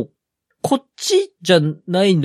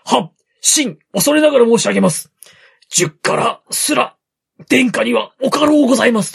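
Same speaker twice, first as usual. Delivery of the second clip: higher, formal vassal address; humble retainer; 6 semitones higher, 3 dB louder than usual. Retold or imitated. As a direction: plead politely like a court servant. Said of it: "っていうので、".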